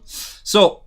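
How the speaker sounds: usual speaking voice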